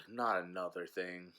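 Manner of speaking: low, soft sigh and grumble; weary dog. Retold or imitated. Fed-up dog voiced seeing the cat, low weary grumble. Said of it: "Goober's just like".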